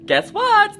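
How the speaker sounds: excited. Acted. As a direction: ask it like a big surprise. Teasing reveal to children.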